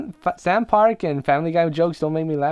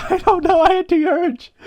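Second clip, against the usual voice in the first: high, laughing squeal; giggling excuse; 14 semitones higher, 5 dB louder than usual. Real, not acted. Co-host scolded over the mic, giggling excuse. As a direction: plead helplessly through laughter.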